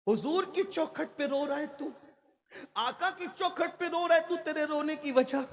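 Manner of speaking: high, sustained questioning voice; concerned questioning. Acted. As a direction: call out the question to the weeping man, high. Questioning a weeping man at the door. (ऊँचे सुर में रोने की वजह पूछते हुए)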